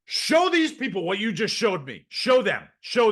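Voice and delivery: excited boss ordering a repeat demo; urgent demand. Retold or imitated. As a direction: push them to show it, forcefully.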